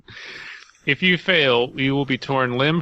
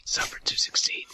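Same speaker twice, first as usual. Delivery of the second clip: mock whisper; 8 dB quieter, faster than usual. Repeating the ritual line.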